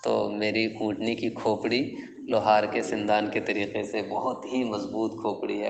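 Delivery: usual speaking voice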